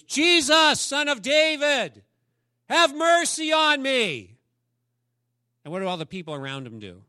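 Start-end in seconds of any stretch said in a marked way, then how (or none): 0.0-4.4 high, loud, slow desperate cry; beggar crying out. Acted. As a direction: call out across the crowd for mercy. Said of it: "He yells louder".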